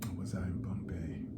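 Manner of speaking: breathy whisper, dropped right down; whispered aside. Real, not acted. Doubting herself about the city name, in a whisper. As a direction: whisper it to yourself like a private second thought.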